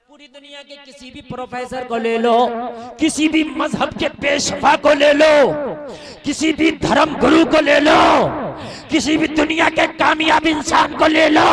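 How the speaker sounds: high shouted challenge, ringing on each ending; full-force preaching. Real, not acted. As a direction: cry the list out to the crowd. (ललकारती हुई ज़ोरदार तक़रीर)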